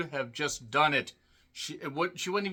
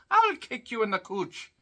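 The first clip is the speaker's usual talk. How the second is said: leprechaun voice, pitched higher; a playful leprechaun taunt; 8 semitones higher than usual. Retold or imitated. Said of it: "Why they're talking like a leprechaun".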